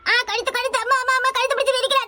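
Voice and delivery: high-pitched cries for help; panicked, repeated high yelps; choked alarm. Acted. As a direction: cry out as if grabbed by the throat. Being strangled in a quarrel.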